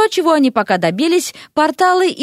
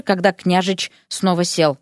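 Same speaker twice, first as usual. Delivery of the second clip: ordinary delivery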